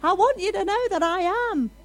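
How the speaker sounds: God shouting from heaven to all people. Retold or imitated. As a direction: thunder it down from heaven. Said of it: "it's like God's shouting from heaven".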